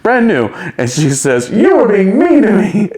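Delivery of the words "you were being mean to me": high, loud, huffy mock outburst; in a huff. Newcomer complaining to castmate about mean treatment, in a mock huff. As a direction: imitate someone worked up and wronged. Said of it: "gets all in a huff".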